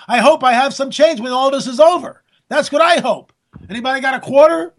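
ordinary talk

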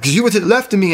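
high, frantic accusing voice; retold freak-out, urgent and high. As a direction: re-enact panicked suspicion, pitch way up. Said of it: "I'm freaking out".

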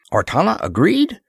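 high, rising, incredulous question; taken aback. A narrator's reading. Ross hearing that Artana let her go, taken aback. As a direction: question it in open surprise. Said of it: "Ross was taken aback".

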